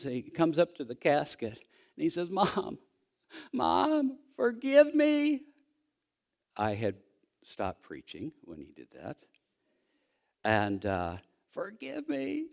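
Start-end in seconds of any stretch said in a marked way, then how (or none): 2.3-5.4 cracking high cry, rising higher on each word; a son begging his dead mother through tears; sobbing plea. Retold or imitated. Grieving son at casket cries out to mother, high sobbing plea. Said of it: "and he's sobbing". 11.5-12.5 sobbing plea. Retold or imitated. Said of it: "just sobbed".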